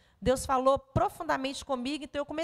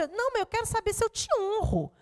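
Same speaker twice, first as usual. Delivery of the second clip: son's voice; 8 semitones higher than usual. Retold or imitated. Mother voices her son.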